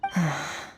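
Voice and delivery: low, quiet disappointed sigh; resigned exhale; soft sigh. Acted. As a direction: let out a weary breath. Skirk disappointed by their news.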